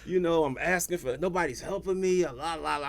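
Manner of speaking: high-pitched retold griping to God; mock self-pitying rant, trailing into la-la; bitching. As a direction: act out a whiny run of complaints. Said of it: "I'm bitching and bitching and bitching".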